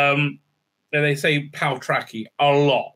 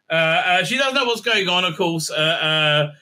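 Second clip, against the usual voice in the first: normal talking voice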